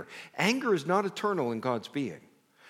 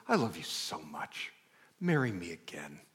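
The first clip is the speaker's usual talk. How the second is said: much lower, soft and slowed, a tender proposal; 10 semitones lower, 5 dB quieter than usual. Retold or imitated. Husband proposing at hospital bed, low and tender. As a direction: murmur it low like a lover on one knee.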